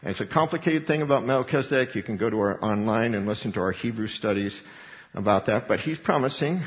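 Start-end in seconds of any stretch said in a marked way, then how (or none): none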